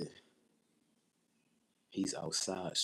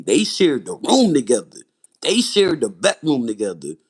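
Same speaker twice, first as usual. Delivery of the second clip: loud, raised-pitch exclamation; outraged emphasis; 4 semitones higher, 13 dB louder than usual. Real, not acted. Telling viewers what the brothers shared, loudly aghast. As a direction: drive the fact home at high volume.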